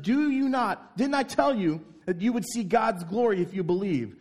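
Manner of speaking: loud, high, angry insistence; said with gusto. Acted. Jesus answering Martha, with loud gusto. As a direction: drive it home with force. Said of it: "He's saying this with gusto".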